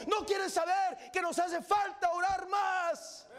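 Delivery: fiery revival preaching; preaching at full force. Acted. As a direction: preach it to the back row. Preacher's climax, shouted.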